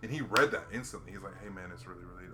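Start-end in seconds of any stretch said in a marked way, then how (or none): 1.4-2.3 a softly lowered greeting; quiet greeting to the shy brother. Retold or imitated. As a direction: drop the voice to greet him quietly. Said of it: "lowered his voice".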